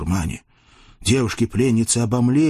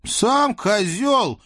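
angry; 12 semitones higher, much slower than usual. Acted. Sotnik's wife snaps back.

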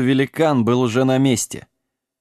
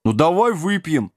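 raised-pitch sheepish backing down; embarrassed; 6 semitones higher than usual. A narrator's reading. Giant agrees to drink, embarrassed. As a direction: give in, flustered. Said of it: "смутился великан".

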